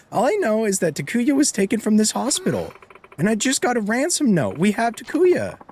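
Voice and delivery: mother voiced high, anxious and fussing; high fretful mother. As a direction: plead as a worried mother in a hospital. Voicing the mother reading dialogue.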